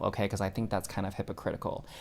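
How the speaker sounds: normal talking voice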